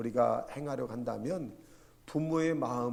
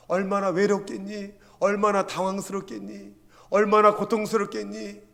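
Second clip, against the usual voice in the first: loud, higher, building repeated appeals; fervent empathy; 6 semitones higher, 10 dB louder than usual. Acted. Preacher modelling comfort for the hurting, fervent and building. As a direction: press each consoling question harder.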